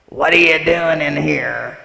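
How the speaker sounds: loud, slow, challenging call; startling voice from the house. Retold or imitated. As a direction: boom it out from another room, slow. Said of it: "came a voice".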